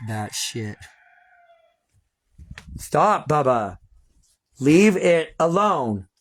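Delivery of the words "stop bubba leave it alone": yelling at the dog. Real, not acted.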